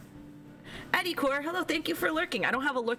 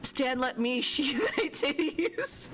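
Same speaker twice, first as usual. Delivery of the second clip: laughing tease; 4 semitones higher, much slower than usual. Real, not acted. Teasing the character, laughing.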